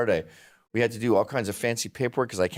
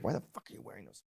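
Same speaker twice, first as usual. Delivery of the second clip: quick, hushed mock disbelief; retold quiet aside; 6 semitones lower, 15 dB quieter, faster than usual. Retelling a question to a flip-flop wearer, quick hushed aside. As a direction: mutter it fast under your breath. Muttered aside to friend in office.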